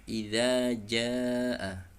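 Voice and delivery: Idza jaa slow, dh with the tongue out, long jaa; correct idza. Shown as a demonstration. Teacher models the right sound. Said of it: "ujung lidah dikeluarkan sedikit".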